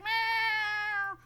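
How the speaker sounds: high, long-held meow; cat meow. Retold or imitated. Cats running to the door, high drawn-out meow. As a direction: imitate cats greeting at the door. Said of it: "they're just like".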